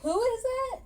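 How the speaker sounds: bewildered and climbing; shocked family questions. Retold or imitated. Family gasping at her confession, shocked and confused. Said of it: "And so she's like".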